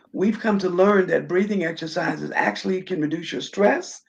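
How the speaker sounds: usual speaking voice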